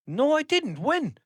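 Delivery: high-pitched, indignant disbelief; indignant denial. Real, not acted. Podcaster disputes AI assistant's claim about its nickname, indignant. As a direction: protest your innocence, pitched right up.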